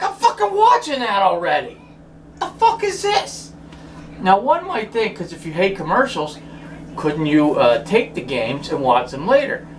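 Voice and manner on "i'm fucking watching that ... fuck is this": incredulous outrage. Retold or imitated. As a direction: stretch out the final question, fed up. Quoting his own frustration.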